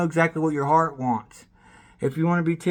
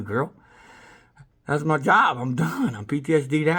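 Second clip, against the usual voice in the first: ordinary talk, nothing marked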